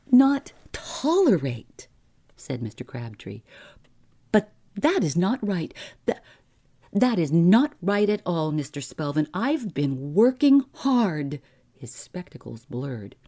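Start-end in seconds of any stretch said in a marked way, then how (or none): 0.1-1.9 high, loud, drawn-out disbelief; aghast echo. A narrator's reading. Clerk hearing he may lose his job, aghast disbelief. 4.3-11.4 high, loud, stammering objection; agitated protest. A narrator's reading. Clerk protesting dismissal to his employer, agitated protest.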